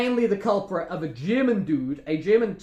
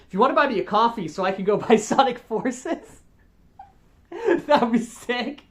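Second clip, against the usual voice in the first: laughing; 5 semitones higher, 4 dB louder than usual. Real, not acted. Laughs at own coffee joke.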